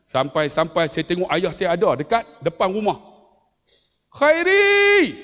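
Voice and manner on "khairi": loud, high-pitched drawn-out call; raised calling shout, acted out. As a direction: call the name across a yard. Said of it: "Saya sebut Khairi".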